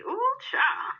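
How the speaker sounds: high, crying-out ooh; swooning squeal. Real, not acted. Host swooning over handsome bartender. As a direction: squeal with delight.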